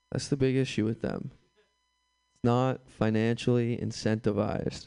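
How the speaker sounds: normal talking voice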